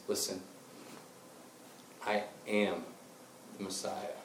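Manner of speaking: soft, solemn, with weighty pauses; hushed revelation. Acted. Jesus finally telling the woman, hushed and solemn. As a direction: reveal it quietly, piece by piece.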